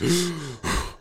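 high gasp of effort; sudden pained intake of breath; acted gasp. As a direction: gasp like a wounded master. Acts out wounded master's breath.

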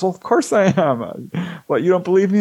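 mock indignant Wendy. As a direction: play her mildly affronted. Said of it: "And she says".

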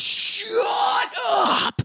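very high, drawn-out shriek; mock furious scream. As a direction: screech it, hammed-up and frantic. [name] yelling at the friend.

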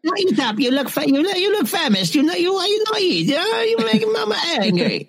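put-on high Italian mama accent, scolding; a fussing Italian mother urging food. Old Italian mama nags a famished guest, high accent.

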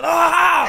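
high, loud angry shout; rage yell. Retold or imitated. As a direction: explode in a burst of fury. Acting sudden rage.

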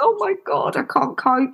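high distressed cry of oh my God; retold despair. Crying upstairs after daughter leaves, high and distressed. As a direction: sound at the end of your rope.

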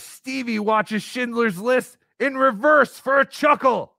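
slow, high shouted announcement; mock shout. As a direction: belt it out like a ringside announcer. Reading out a roast donation message.

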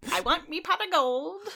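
high Irish leprechaun voice; a cheeky Irish leprechaun accent. Pretend. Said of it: "hit us with a leprechaun quote".

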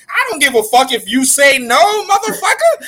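laughing shout. Real, not acted. Heated banter about the title.

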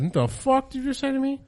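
high-pitched incredulous retort; mock disbelief. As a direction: act out being floored by the answer. Retold shock at uncle's casual answer.

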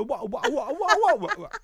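high, laughing wah wah voice of the chef; mocking English chef. Coworkers mocking the English chef, high laughing wah. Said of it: "He's over there going".

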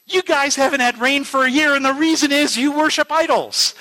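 a fiery street preacher rebuking the village; retold preaching. [name] retelling the preacher's rebuke, lightly preachy. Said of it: "he started to preach".

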